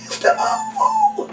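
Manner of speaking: mocking a shy child's little shout; tiny squeaky voice. Shown as a demonstration. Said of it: "you're very funny when you get a little one".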